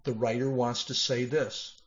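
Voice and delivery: ordinary delivery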